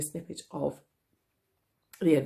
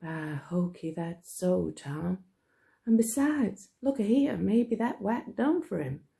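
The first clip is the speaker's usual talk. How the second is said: Huck's voice; 3 semitones higher, slower than usual. Acted. Huck voiced in read story.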